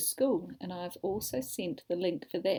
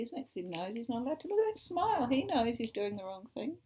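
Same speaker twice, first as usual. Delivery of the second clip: ordinary delivery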